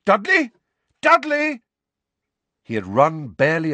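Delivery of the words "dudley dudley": desperate calling out. Acted. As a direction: shout the name across the alley. Harry calling out to Dudley.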